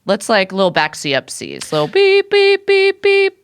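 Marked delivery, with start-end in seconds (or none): 1.7-3.4 high, even repeated beeps; imitated reversing beeper. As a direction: mimic a truck backing up.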